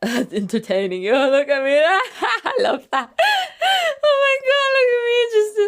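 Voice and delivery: high, loud squeals through laughter; laughing delight. Real, not acted. Vlogger delighted at seeing their own antics, laughing delight. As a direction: burst out laughing while talking. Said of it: "of course I'm laughing".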